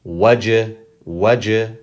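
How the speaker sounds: Wajh as waj with a bouncing j; short, echoed j ending Wajh; qalqala on jim. Shown as a demonstration. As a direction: release the j of Wajh with a tiny echo. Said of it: "talked about the qalqala with the jim".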